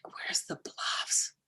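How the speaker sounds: slow, breathless whispered echo; a mother hearing her son is lost, whispering in shock; stunned whisper. Retold or imitated. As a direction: repeat it in disbelief under your breath.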